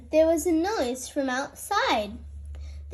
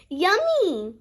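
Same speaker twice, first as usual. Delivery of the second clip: happy cry; 4 semitones higher than usual. A narrator's reading. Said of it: "they cried".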